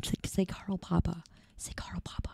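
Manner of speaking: soft, urgent whisper; coaxing whisper. Real, not acted. Streamer coaxing someone to say Carl Papa, whispered. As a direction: egg them on under your breath.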